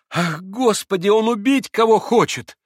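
high, loud horrified maid; horrified cry. A narrator's reading. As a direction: throw up your hands in terror. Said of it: "всплеснула руками Феня".

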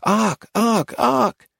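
put-on high, repeated childlike calls; children clamouring. A narrator's reading. Children mobbing Arcady for attention, high clamouring. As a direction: clamour like a crowd of little children. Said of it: "a mob of naked children clamouring".

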